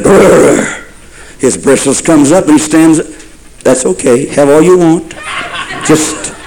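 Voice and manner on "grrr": rolling animal growl; bear growl. Acted. Preacher playing the bear as skunk approaches, growling. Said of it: "and the bear said".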